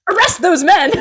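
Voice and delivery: hammed-up royal shout; mock order. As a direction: call it out like a pompous prince. Acting out the prince's order.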